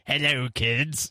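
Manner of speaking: slow, creepy greeting; spooky voice. Shown as a demonstration. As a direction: greet the kids like a ghoul. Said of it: "I'm gonna go".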